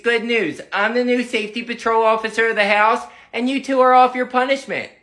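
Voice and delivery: ordinary talk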